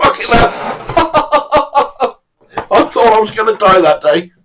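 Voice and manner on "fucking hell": laughing. Real, not acted. Relief after scary encounter.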